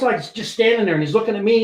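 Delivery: ordinary talk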